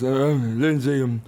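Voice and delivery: a hint of a drunk mutter out of the side of the mouth; lightly slurred, tired drunk mumble; mumbled drunk dad. Daughter imitating her drunk dad at the in-laws' dinner, mild mumble. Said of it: "Why does he speak out of the side of his mouth?".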